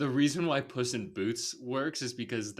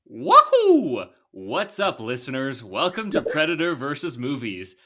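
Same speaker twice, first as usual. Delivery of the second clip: Mario; 3 semitones higher, 11 dB louder than usual. Acted. Host opening the podcast as the game hero, exuberant Mario voice. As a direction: belt it out as a cartoon plumber. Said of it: "my Mario voice".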